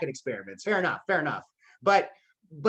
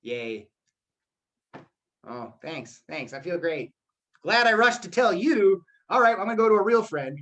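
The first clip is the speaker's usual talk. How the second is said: mock a friend's news with no energy; a friend hears hockey team news and answers dead flat; deadpan yay. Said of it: "you just turn over and you're like".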